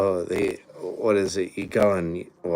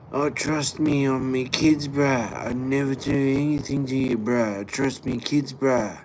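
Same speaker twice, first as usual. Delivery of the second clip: higher, louder, slow pleading junkie voice with bruh; imitating a street junkie; 6 semitones higher, 5 dB louder than usual. Junkie swearing on his kids to strangers, hammed-up higher pleading voice. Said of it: "what's the other one".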